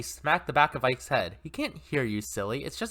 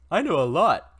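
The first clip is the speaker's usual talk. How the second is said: louder cartoon pig voice; 3 semitones higher, 7 dB louder than usual. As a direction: boast it like a cheerful cartoon pig. Pig voice continues.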